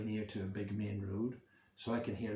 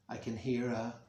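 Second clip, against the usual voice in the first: ordinary delivery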